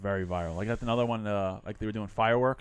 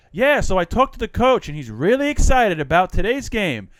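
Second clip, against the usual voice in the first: much higher, louder, eager repeated report; imitated Spanish sports reporter; 8 semitones higher, 9 dB louder than usual. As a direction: rattle off the same line like a nervous reporter.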